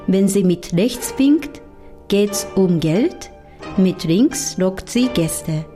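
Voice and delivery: ordinary talk, nothing marked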